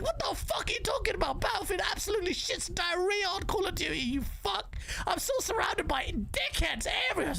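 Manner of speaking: high-pitched, exasperated outburst; furious rant. Real, not acted. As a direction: rage at the fools, pitch way up. Angry comeback turning into rant.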